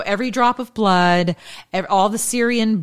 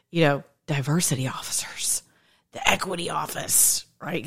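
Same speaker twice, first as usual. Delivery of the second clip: whispered "diversity officers" in a low hush; snide whisper; 3 semitones lower, 6 dB quieter than usual. Acted. Aside to viewers about officials, a snide whisper.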